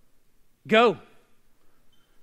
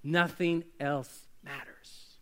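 soft slow emphasis; 5 semitones lower, 9 dB quieter, much slower than usual. Acted. Preacher drops voice for emphasis.

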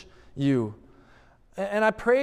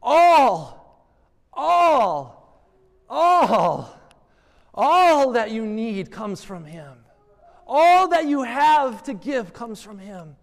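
loud, high preaching, slow on each "all"; preaching at full force; 6 semitones higher, 6 dB louder, slower than usual. Acted. Pastor driving home the point to church, loud and high.

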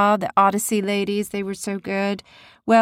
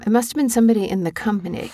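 normal talking voice

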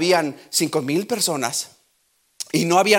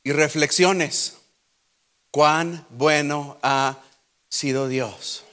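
slow reflection; much slower than usual. Real, not acted. Preacher inviting listeners to reflect, slow and tender. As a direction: let each word sink in gently.

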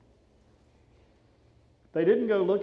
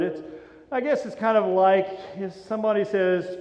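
usual speaking voice

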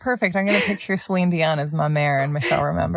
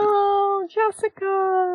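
high, drawn-out "aww" tone; sympathetic coo; 13 semitones higher, much slower than usual. Real, not acted. Hearing Jessica expected perfection, sympathetic coo. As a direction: coo it slowly with pity.

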